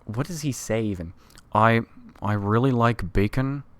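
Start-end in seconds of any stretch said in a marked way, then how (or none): 1.5-3.6 lightly voiced slow tough guy; a hint of a gruff cartoon cop bragging; Joe Swanson impression. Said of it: "That's my Joe Swanson".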